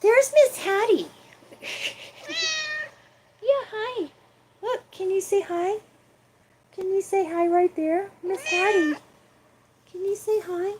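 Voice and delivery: high sing-song talk to a cat. Real, not acted. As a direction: coo at the kitty.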